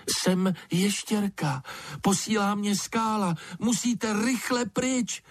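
high-pitched urgent call, not shouted; calling into darkness. A narrator's reading. Lizard urges the hidden creatures to flee, calling out high. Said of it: "volala ještěrka do hluboké tmy".

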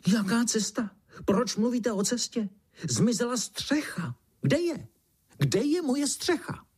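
high-pitched screechy beetle voice; screeching beetle. A narrator's reading. Beetle missing his roof, screeching. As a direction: screech like an indignant little beetle. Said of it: "ozvalo se vřískání".

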